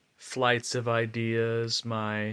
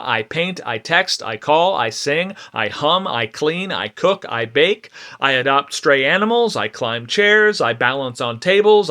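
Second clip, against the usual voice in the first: racing, pressured, ever-louder stream of thoughts; manic monologue; 4 semitones higher, 12 dB louder than usual. Acted. Performer acting out her own manic mind, frantic and driven. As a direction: spill every idea out at once, unable to stop.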